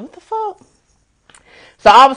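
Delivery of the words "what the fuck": high-pitched, softer "what the...?"; mock-baffled, voice shooting up high; retold bewilderment. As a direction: squeak out your disbelief, reenacting it. Retold shock at being pulled out.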